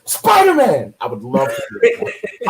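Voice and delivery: hammed-up excited street shout; bystander calling out. Movie extra on the street spotting a hero, excited shout. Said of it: "like, the person on the street".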